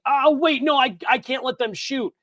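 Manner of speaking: mildly panicked retold thought; mock panic. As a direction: act out a player's sudden panic. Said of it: "of panicked and was like".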